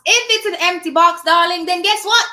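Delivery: loud, high comeback; emphatic retort. Acted. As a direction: fire back loudly at the doubter. Answering the imagined doubtful viewer.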